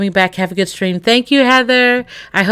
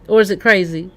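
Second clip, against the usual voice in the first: ordinary talk, nothing marked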